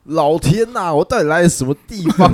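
loud, high exasperated cry to the heavens; a patient stunned by a harsh doctor, loud and high; exasperated disbelief. Retold or imitated. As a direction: throw up your hands in mock despair. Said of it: "我当下的想法只是".

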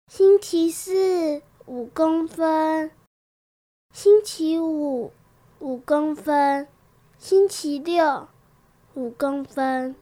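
slow, fading disappointment, trailing down; growing dejected. Acted. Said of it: "他的声音听起来越来越沮丧".

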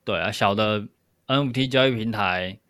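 ordinary talk, nothing marked

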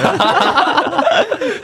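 high laugh at the friend's punchline; high burst of laughter. Real, not acted. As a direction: crack up high.

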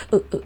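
short hiccupping mock sobs; two choked little whimpers, acted; imitated child's sobbing. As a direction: imitate a scolded child sniffling. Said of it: "啜泣".

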